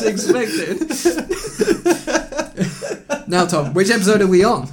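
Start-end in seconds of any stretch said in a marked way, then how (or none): 0.8-3.2 high, bubbling burst of laughter; a real, free laugh; high giggle before the intro. Real, not acted. Host laughs opening the episode.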